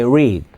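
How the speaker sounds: "read" low, with an English R; "read" falling, R not L; English R sound. Shown as a demonstration. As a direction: say "read" with the tongue curled back. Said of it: "이게 영어의 R과 L예요".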